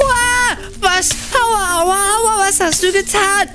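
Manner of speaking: high, yelping alarm; pained panic. Acted. Victim of brother's fire magic, panicked and yelping. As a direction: cry out in pain as flames hit.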